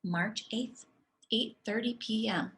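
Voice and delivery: ordinary delivery, nothing marked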